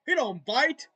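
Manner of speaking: hammed-up disbelief; incredulous. Acted dog-owner argument.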